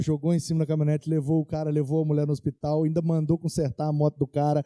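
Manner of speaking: ordinary talk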